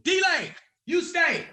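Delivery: high loud command; security guard. Retold or imitated. Security guard telling the comic to stay behind. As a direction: call him back like a bouncer.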